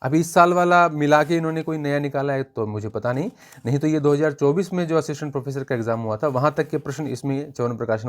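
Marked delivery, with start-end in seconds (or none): none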